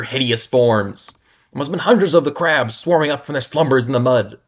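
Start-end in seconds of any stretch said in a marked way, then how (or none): none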